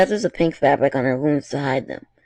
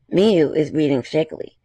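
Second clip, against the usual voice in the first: usual speaking voice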